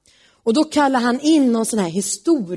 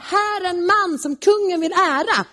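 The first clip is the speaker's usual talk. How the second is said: calling it out high and grand; proud herald's cry, pitched up; proud proclamation; 8 semitones higher than usual. Retold or imitated. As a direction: announce him like a herald, pleased with himself. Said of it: "så ska man ropa".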